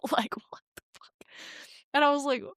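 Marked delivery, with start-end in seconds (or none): 0.1-1.1 whispered disbelief. Real, not acted. Still in disbelief.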